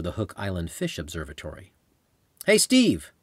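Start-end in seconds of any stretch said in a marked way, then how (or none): 2.4-3.1 high, loud call across water; shouted. Acted. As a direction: call out to him from afar. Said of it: "I shouted".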